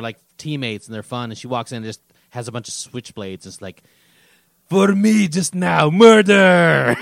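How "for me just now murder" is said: slow, high, loud Latin seductress parody; mock Latin accent. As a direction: proclaim it slowly in a fake Latin accent. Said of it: "same lady walks".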